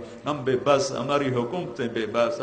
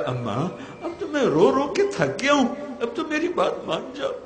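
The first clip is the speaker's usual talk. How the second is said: put-on child pleading to mother; 12 semitones higher than usual. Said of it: "جیسے بچہ روتے روتے اماں سے کہتا ہے".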